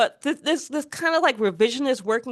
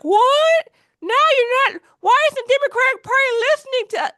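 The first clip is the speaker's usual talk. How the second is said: high, loud, incredulous mock complaint; hammed-up indignation, pitched way up; mock outrage; 13 semitones higher, 5 dB louder than usual. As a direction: squawk like an affronted voter thrown out. Mimicking voters outraged at being dumped.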